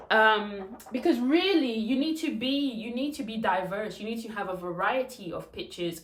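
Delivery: ordinary talk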